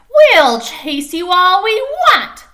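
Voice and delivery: high, loud, slow chant of the fans; crowd yelling. Acted. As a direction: shout it like a crazed mob. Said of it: "the screaming crowd".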